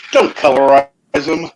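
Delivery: ordinary delivery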